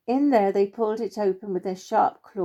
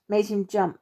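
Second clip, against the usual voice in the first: plain reading voice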